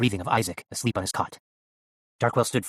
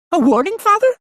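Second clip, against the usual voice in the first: loud, very high puppet voice; questioning doll; 11 dB louder than usual. A narrator's reading. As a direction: ask your maker in a piercing doll voice.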